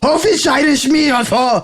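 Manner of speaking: put-on angry German accent. Friend shows off a German accent by just sounding angry, angry mock German. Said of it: "German accent is just sound ang".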